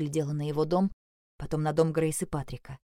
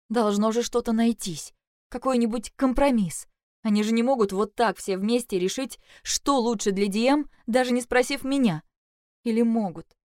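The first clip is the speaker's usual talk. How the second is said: higher, urgent, pleading reasoning; agitated inner protest; 6 semitones higher, 4 dB louder than usual. A narrator's reading. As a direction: argue desperately with yourself, pitch raised. Героиня в отчаянии рассуждает про себя. (взволнованно, высоким голосом)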